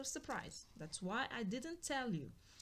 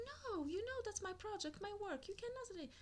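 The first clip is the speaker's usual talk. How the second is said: higher, insistent mock wife voice; imitating the wife; 9 semitones higher, 4 dB quieter than usual. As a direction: play the wife pushing back, pitched up. Wife refusing husband's plan.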